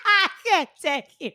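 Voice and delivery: high, laughing through words. Real, not acted. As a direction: crack up at the joke. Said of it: "You're too funny".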